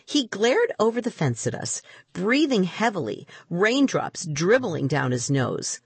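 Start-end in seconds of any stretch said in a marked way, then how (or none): none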